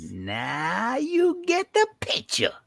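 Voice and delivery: slow, high-pitched gloat; pleased schemer letting it sink in; knowing triumph. Acted. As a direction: draw it out high, savouring the scheme. Scheme lands with the listener.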